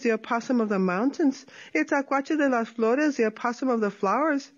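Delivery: sly sing-song opossum boasting; lightly voiced sly opossum. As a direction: tease the coyote with a light lilt. Tlacuache voiced in story.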